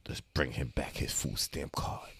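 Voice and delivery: whispered and slow; hushed advice. Retold or imitated. Aunt quietly telling her nephew to give the card back, whispered.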